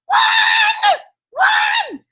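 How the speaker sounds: very high, loud shouting for help; panicked call. Retold or imitated. Guest shouting to her friend Juan for rescue. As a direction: yell for your friend to rescue you.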